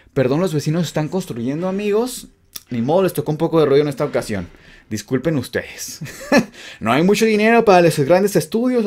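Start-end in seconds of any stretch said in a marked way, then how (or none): none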